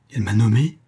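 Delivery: startled question with a rising end; astonished exclamation. A narrator's reading. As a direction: exclaim it in mild disbelief. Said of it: "s'écria le baron". (s'écrier, surpris, en montant à la fin)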